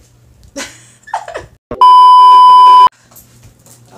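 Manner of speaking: big burst of laughter; loud high laugh. Real, not acted. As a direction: cackle out loud.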